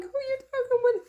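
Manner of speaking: retold voice scolding her tone; mock indignation. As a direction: squeal it in mock outrage. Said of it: "I'm like".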